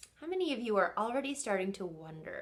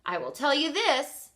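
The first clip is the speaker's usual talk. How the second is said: a touch louder, higher mysterious dad; dad's voice; 5 semitones higher, 6 dB louder than usual. Acted. Dad teasing his son about the recipe, mild mystery. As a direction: tease him with the secret. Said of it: "he said".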